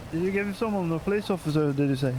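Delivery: ordinary delivery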